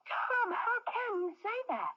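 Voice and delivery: high, tearful plea to her husband; distressed crying plea. Acted. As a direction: cry it out at him, hurt. Wife pleads in quarrel.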